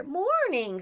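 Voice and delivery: high exclaimed echo; alarmed Santa. Acted. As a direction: pitch it up in storybook surprise.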